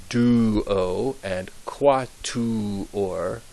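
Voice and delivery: duo and quatuor, drawn out with pure oo; very slow example words. Shown as a demonstration. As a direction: crawl through each syllable. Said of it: "as in".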